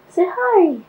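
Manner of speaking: high, loud, sing-out coaxing; cooing greeting. Real, not acted. Vlogger coaxing Chloe to greet the camera, cooing. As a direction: call it out high and playfully.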